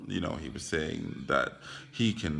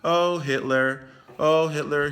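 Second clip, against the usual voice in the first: higher, louder, hammed-up laughing along; mock amused crowd; 7 semitones higher, 6 dB louder than usual. Everyone laughing along at the comedian, mock amusement. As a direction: imitate people finding it hilarious. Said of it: "everyone started laughing at him".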